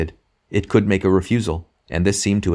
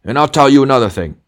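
louder and higher, hardest at the start; angry rebuff; 8 semitones higher, 9 dB louder than usual. A narrator's reading. Ghost guarding his private affairs, angry. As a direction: shut down the impudence with bluster. Ghost warns the other angrily.

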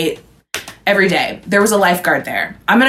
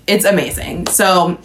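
normal talking voice

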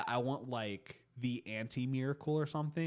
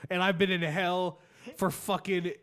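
aggrieved demon griping, louder and higher; angry demon; 7 semitones higher, 5 dB louder than usual. Acted. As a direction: rant like a cheated demon, building up. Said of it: "demons are probably so angry".

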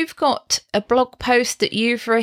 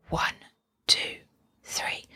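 soft whispered one two three; whispered count; 10 dB quieter than usual. Shown as a demonstration. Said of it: "in my mind I go".

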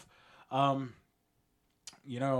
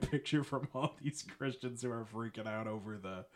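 ordinary talk, nothing marked

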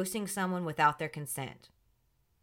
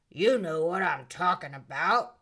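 lower, slurred knowing tone; mock drunk voice; 3 semitones lower than usual. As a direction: play the tipsy woman insisting. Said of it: "Denise is like".

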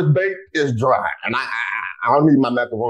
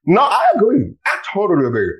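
eager; 8 semitones higher, 4 dB louder than usual. Real, not acted. Agreeing eagerly with guest.

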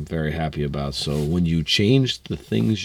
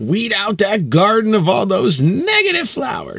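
mock preachy; 5 dB louder than usual. Teasing exaggerated echo of advice.

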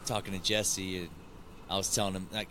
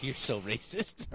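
mock accusation; 3 semitones higher, slower than usual. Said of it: "everybody looks at him like".

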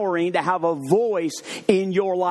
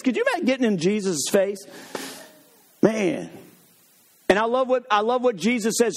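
ordinary talk, nothing marked

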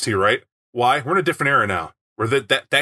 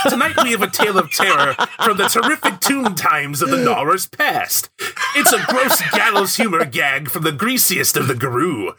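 high, hammy Crypt Keeper host voice; vintage horror narrator; 6 semitones higher than usual. Acted. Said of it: "Mother Larissa's sweet, sweet intro".

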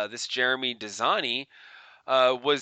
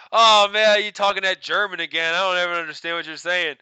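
teasing friend; 5 semitones higher, 8 dB louder than usual. Retold or imitated. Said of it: "I used to be like".

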